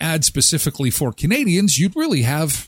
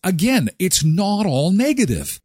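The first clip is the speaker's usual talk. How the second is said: usual speaking voice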